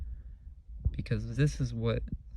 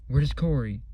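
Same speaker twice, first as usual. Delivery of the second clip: louder, clipped angry demand; retold furious question; 3 semitones higher, 6 dB louder than usual. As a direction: bark it like an angry intruder. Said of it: "And he was pissed".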